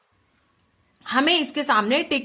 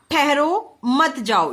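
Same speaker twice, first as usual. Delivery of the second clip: "ठहरो" held rising, then a break; pause after ठहरो; much slower than usual. Shown as a demonstration. Teacher reading the girl's order to stop, pausing after "ठहरो". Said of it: "ये इन्हें रोकने का संकेत दे रही है".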